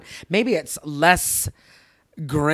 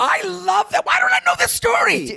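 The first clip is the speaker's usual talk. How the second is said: high, delighted shout; thrilled cry at a friend's story; excited squeal. Real, not acted. Friend hears a cute kiss story.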